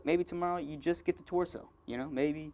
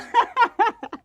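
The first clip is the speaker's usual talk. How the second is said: high laugh; 8 dB louder than usual. Real, not acted. Laughing at own joke.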